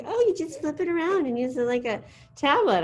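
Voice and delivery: airy student impression; imitating students. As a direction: toss it off like the kids explaining. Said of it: "everyone's like".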